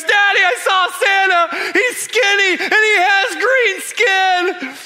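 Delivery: put-on high, loud frightened little girl's cry; terrified child. Scared daughter telling her dad about Santa, high frightened shout. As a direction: shriek it like a scared little girl who saw a monster. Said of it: "Hannah Grace goes".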